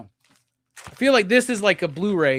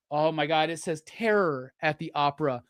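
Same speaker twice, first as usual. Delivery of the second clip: normal talking voice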